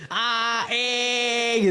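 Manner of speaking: long, projected "A, E" with wide mouth; exaggerated vowel articulation. Shown as a demonstration. Coach showing mouth exercise, exaggerated vowels. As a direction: stretch out each vowel of "A, E". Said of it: "A-nya harus tiga jari".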